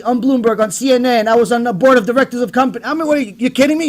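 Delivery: ordinary talk, nothing marked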